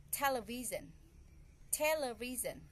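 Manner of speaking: "television" with TEL punched, then falling; stress on first syllable. Shown as a demonstration. Teacher showing where stress goes, "television" stressed on TEL. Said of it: "I raised up my voice".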